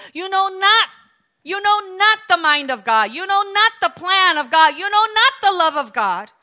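loud, punching NOT each time; stress on not. Acted. Preacher to congregation, punching not loudly. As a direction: drive home "not" in every line.

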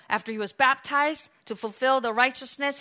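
normal talking voice

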